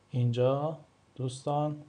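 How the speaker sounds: ordinary talk, nothing marked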